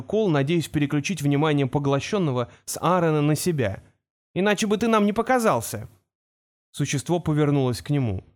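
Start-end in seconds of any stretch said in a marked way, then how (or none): 4.3-5.9 higher-pitched, pointed question; challenging boy. Acted. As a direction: speak up to draw its attention. Кол отвлекает внимание существа на себя.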